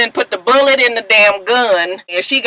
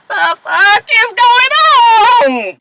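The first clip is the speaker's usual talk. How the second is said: higher, slow, drawn-out disbelief; baffled; 13 semitones higher, 3 dB louder, slower than usual. Retold or imitated. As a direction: stretch it out like you can't believe it. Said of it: "Lucius is just like".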